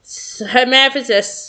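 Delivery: ordinary delivery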